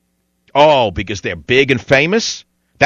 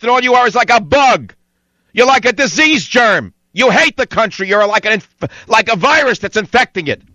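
furious yelling; 9 semitones higher than usual. Real, not acted. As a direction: scream it at your enemies. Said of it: "I can still put out the horsepower".

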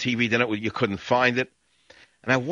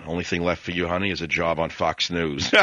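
ordinary talk, nothing marked

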